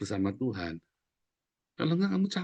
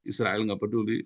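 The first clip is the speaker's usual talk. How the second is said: normal talking voice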